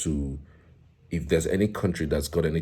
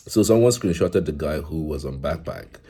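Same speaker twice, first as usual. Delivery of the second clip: usual speaking voice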